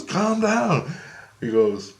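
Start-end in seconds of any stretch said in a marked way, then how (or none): 0.0-0.9 high, imitated voice; imitated soothing plea. Grace tells the upset dreamer to settle, high imitated plea. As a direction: act out a high-pitched soothing call.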